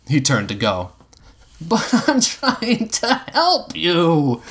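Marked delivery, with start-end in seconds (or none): 1.6-4.4 high, slow sobbing plea; drawn-out whimpering protest; pathetic crying. A narrator's reading. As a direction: wail it pathetically through tears. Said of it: "Josh cried pathetically".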